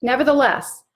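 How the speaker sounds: ordinary delivery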